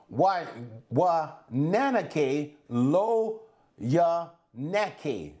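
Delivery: slow, careful Hebrew read-out; slow Hebrew recitation; Hebrew phrase. Shown as a demonstration. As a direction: sound out the Hebrew slowly, syllable by syllable. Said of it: "let me tell you how it goes".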